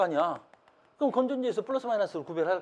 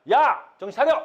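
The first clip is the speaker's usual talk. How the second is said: high, loud self-rebuke; scolding shout; 7 semitones higher, 6 dB louder than usual. Real, not acted. Teacher snapping out of a botched example, scolding shout. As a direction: shout yourself back to attention.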